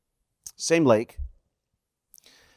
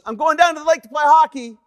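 high, raised voice carrying upstairs; calling up; 12 semitones higher, 4 dB louder than usual. Retold or imitated. Son tells dad his hockey plans, calling up high. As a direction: call your plans up to Dad. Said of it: "he called up to me".

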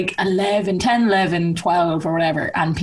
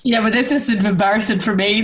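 usual speaking voice

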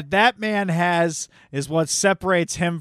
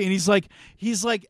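ordinary talk

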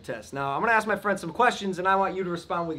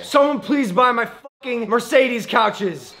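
exasperated plea; 7 semitones higher, 5 dB louder, slower than usual. Real, not acted. Begging loudly for birthday gift.

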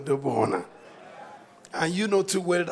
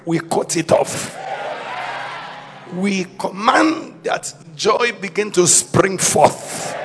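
loud commanding preacher's declaration; preaching at full force; 8 dB louder than usual. Acted. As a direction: proclaim victory over the church.

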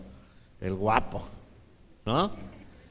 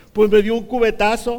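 loud, higher complaint; mock outraged mom; 6 semitones higher, 10 dB louder than usual. Mom tells people about her son, loud mock outrage. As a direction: blurt it out as an aggrieved mother. Mom retelling the bucket blow.

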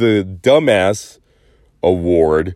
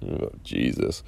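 whispered oh jesus; 10 semitones lower, 14 dB quieter than usual. Real, not acted. Exasperated aside, breathy.

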